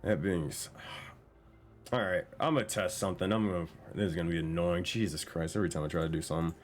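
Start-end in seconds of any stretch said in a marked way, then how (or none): none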